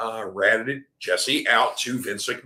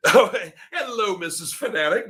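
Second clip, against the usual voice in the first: high, laughing hello to his wife; light up and laugh as you greet her; delighted greeting; 8 semitones higher than usual. Real, not acted. Spots his wife in chat.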